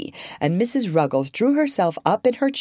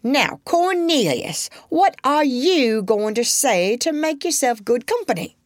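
mother quizzing; 9 semitones higher than usual. A narrator's reading. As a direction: test the boy. Mother questioning Cornelius.

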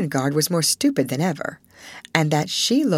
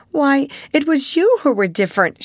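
higher, louder protest; cried out; 9 semitones higher, 4 dB louder than usual. A narrator's reading. Ermengarde protesting to Sarah, crying out. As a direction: fling the blame back at her. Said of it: "she cried".

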